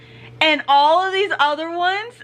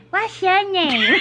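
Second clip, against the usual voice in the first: slow, creepy pickup line; creepy guy imitation; 3 semitones higher, much slower than usual. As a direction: mimic a creep sliding into a conversation. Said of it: "This dude sounded creepy".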